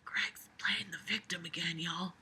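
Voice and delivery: hushed mocking aside; whispering. Real, not acted. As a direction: whisper it like gossip.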